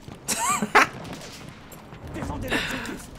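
high wheezy laugh. Real, not acted. Laugh after joke.

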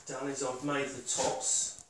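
ordinary talk

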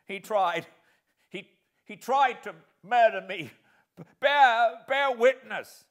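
loud, high breathless pleading; gasping. Acted. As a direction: gasp out the accusation in fear. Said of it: "The other one still gasped".

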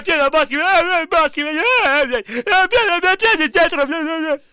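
escalating furious yes yes; raging gamer. Real, not acted. Yelling yes at interview question.

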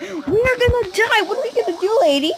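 high-pitched, hammed-up alarm; mock panic. Player asking the lady what to do, high mock panic.

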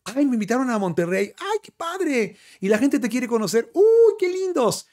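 mock gushing fan-struck voice; naive young self. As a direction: coo like a flattered newcomer. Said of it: "Era así como".

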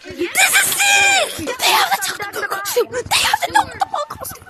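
very high, squealing delight; overjoyed, voice shooting up high; excited squeal. Real, not acted. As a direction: shriek it like a thrilled fan. Thrilled by new items in game.

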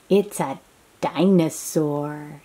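plain reading voice

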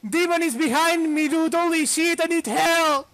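high, loud frightened yelling; panicked shout. Real, not acted. Chased by a demon, screaming to his teammate in panic. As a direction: yell for help with a demon on your back. Demon chasing him in game.

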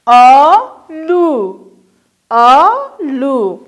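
کشیده و آهسته; drawn out slowly. Shown as a demonstration. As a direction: stretch the word very slowly. Teacher drawing out word for syllables.

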